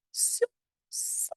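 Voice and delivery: "So" squeaked very high then fading; exaggerated "so". Shown as a demonstration. Therapist modeling a strong feeling to a child, high and stretched. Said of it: "very exaggerated".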